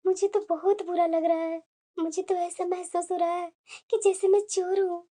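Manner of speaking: low, soft, ashamed voice; hurt inner thoughts. Acted. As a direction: murmur your embarrassment to yourself. Humiliated mother-in-law thinks to herself. (शर्मिंदा होकर धीमे से मन ही मन)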